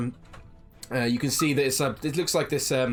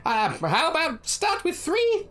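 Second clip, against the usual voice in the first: high sweet gnome shopkeeper; gnome woman voice; 13 semitones higher than usual. Acted. Gnome woman serves Blossom, high sweet voice. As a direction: coo it like a kindly gnome granny. Gnome vendor keeps talking.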